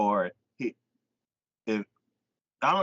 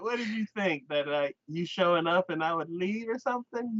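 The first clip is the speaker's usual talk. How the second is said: high-pitched imitated challenge; mock indignation; 8 semitones higher than usual. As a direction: act out a pushy date's disbelief. Voices the persistent date confronting her.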